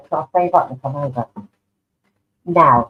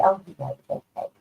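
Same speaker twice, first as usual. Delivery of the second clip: softer, falling, bashful; humble; 2 semitones higher, 7 dB quieter than usual. Pretend. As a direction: play down the praise. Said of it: "taught to be a little bit humble".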